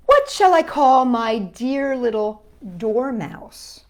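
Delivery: usual speaking voice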